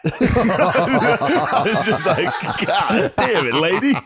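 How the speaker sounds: cracking up mid-retort, pitch way up; laughing. Retold or imitated. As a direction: laugh through an exasperated comeback. Laughing exasperated retort to insult.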